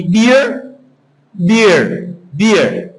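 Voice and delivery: "beer" with an extra-long E; drawn-out E. Shown as a demonstration. Teacher helps students hear the extra vowel, drawn-out E. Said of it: "coloquei um E a mais".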